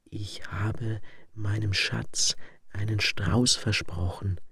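hushed unvoiced whisper, slow; whispered secret. A narrator's reading. Madman confides his secret to the stranger, whispering. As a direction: whisper it with a finger on the lips. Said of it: "indem er den Finger auf den Mund drückte".

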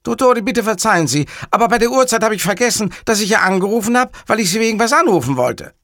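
high, loud, hurried apology; a high-pitched, flustered subordinate rattling out his excuse; hapless caller Cattarella. Acted. Cattarella apologizes to the boss for calling, high and hurried.

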